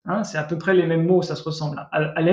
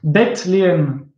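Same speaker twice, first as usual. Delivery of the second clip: Bethléem slowly, in two parts, Beth - léem; word split in parts; slower than usual. Shown as a demonstration. Teacher explaining house of bread, word split in parts. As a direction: pull Bethléem apart after Beth. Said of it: "Et ça vient de là, bête".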